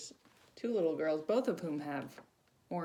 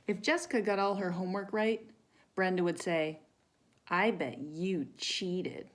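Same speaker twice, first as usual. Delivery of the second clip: storybook reading; 4 semitones higher, 5 dB louder than usual. Acted. Reads picture book to children.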